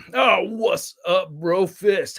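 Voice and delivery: ordinary delivery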